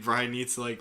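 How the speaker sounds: normal talking voice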